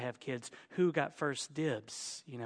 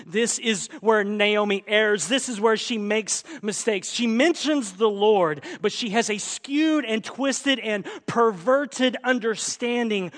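raised, loud sermon delivery; forceful preaching; 6 semitones higher, 8 dB louder than usual. Real, not acted. Preacher declaring where Naomi errs, at full force. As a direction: hammer the point home to the congregation.

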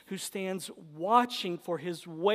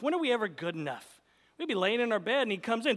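ordinary talk, nothing marked

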